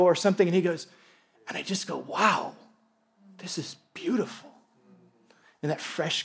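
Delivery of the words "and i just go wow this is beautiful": soft, hushed wonder; quiet awe. Retold or imitated. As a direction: take in the beauty softly. Retells Zach's awe in meadow.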